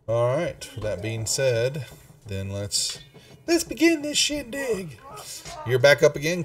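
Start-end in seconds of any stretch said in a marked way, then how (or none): 3.5-5.0 high, sing-song kickoff; excited. Real, not acted. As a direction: start the game with flair. GM opens session excitedly.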